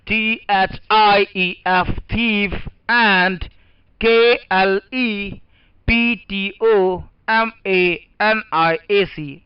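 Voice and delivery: letters spaced out, very slow; slow spelling out. Shown as a demonstration. Teacher spelling out the words for the class, very slowly. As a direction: spell each letter so they can write. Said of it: "लिख लीजिए पहले आप लोग".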